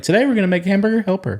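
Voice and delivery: upbeat, fast cooking-show intro; cooking show host. Pretend. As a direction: present it like a TV cook. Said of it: "Do cooking tutorials".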